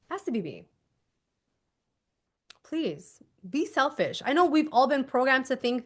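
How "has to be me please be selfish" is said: much softer has to be me; quietly, firmly urge them; gentle plea to be selfish; soft insistence. Shown as a demonstration. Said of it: "soft urging".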